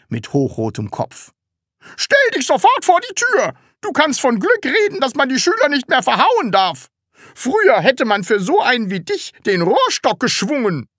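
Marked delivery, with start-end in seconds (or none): 2.0-10.9 high, loud schoolmaster tirade; enraged strict teacher. Acted. Teacher banishing pupil from class, raging. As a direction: rant at full voice.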